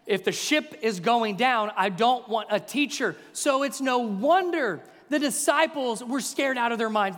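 normal talking voice

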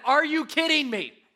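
high, loud, fed-up shout; exasperated yell. Retold or imitated. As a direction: fling the words at the dirty shoes. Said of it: "I said".

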